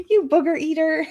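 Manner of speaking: a light playground name-call, half laughing; childish insult. Retold or imitated. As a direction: tease like a grade-schooler. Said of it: "the worst childhood insult you could say".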